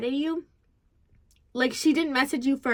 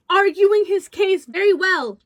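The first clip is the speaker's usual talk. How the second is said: emphatic rant; 5 semitones higher, 8 dB louder than usual. Real, not acted. Ranting about his arguing.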